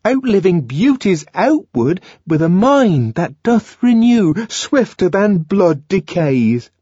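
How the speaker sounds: high, solemn recital of verse; John reciting Shakespeare. A narrator's reading. John quoting Shakespeare to Lenina, solemn. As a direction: intone the lines reverently.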